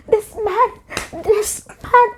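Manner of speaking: very high, speechless disbelief; losing her head. Retold or imitated. Girl stunned that a man pushed her, squeaky incredulous re-enactment. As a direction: squeak it out as if unable to believe it. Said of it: "I'm losing my head".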